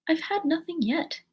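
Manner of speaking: high-pitched mild indignation; offended. A narrator's reading. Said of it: "replied in an offended tone".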